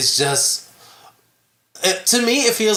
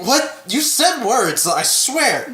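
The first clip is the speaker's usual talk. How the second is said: mock confusion; 7 semitones higher, slower than usual. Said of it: "You're like".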